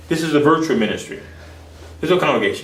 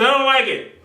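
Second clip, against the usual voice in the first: put-on blunt child; 11 semitones higher than usual. Blunt daughter, laughing father voices.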